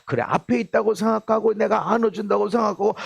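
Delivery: ordinary talk, nothing marked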